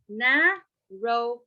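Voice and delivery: "narrowest" slowly as na, row, est; three spaced beats on "narrowest"; broken into syllables. Shown as a demonstration. Teacher showing superlative, "narrowest" split in three. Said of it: "transferring it to comparative, we are saying".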